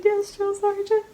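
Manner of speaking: high, small obedient reply; retold meek recruit. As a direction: imitate a cowed recruit answering up. Retold scared recruit answering.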